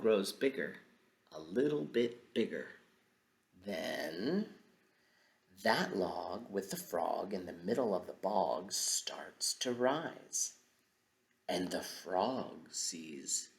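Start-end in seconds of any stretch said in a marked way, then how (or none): none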